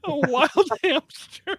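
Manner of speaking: laughing, high and slow at a wild hamster; incredulous. Real, not acted. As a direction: savour the absurd words through a laugh.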